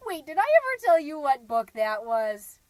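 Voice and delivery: loud burst, snapping out of a reverie; sudden perky recollection. Acted. Mom suddenly remembering her book quiz for listeners, perky outburst. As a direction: jump back in brightly and loudly.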